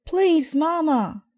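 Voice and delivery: very high, loud desperate scream; screamed plea. Acted. Cubs screaming at Mama from the back seat, very high and loud. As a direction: scream it out in a panic. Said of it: "they screamed".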